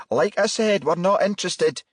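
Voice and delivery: high, firm and curt woman's voice; stern. Acted. Mother firmly refusing the visitors at door, stern. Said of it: "the woman said sternly".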